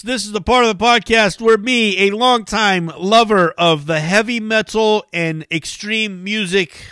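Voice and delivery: high, loud showman announcing; ringmaster. Acted. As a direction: step right up, big-top announcer. Showman opening to the segment.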